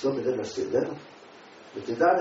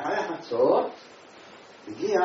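usual speaking voice

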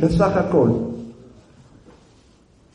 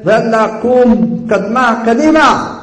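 raised, louder urging command; Joseph ordering; 6 semitones higher, 4 dB louder than usual. Acted. Joseph ordering Judah to get moving, commanding. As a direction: order him up and onward in a high, pressing voice. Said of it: "אומר לו יוסף".

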